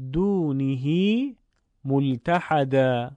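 «دونه» as duunihii, slowly drawn out; reading with ishba. Shown as a demonstration. Teacher introducing the next example, slow lengthened reading. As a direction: read slowly, holding the final i of «دونه».

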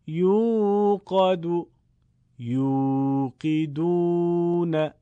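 slow chanted word pairs, first short-ended, second drawn out; short-long contrast recitation. Shown as a demonstration. Teacher models exercise two, slow chanted short and long endings. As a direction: recite each pair slowly, stretching only the waw. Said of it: "ضمه یه ضربی کوتاه".

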